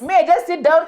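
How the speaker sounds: ordinary talk, nothing marked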